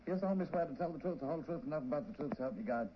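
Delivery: fast routine oath recital; rote court oath. Acted. Clerk reads the oath.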